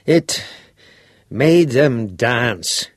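slow, hesitant, forced out; dragging the words out unwillingly; reluctant. Acted. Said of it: "he said reluctantly".

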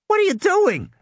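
put-on girl alarmed as Grant turns the boat. A narrator's reading. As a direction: cry it out in panic.

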